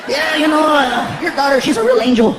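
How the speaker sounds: mock-charming phone impersonation, pitched a little up; Paul Rodriguez. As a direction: pretend to be the star on the phone. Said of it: "be me".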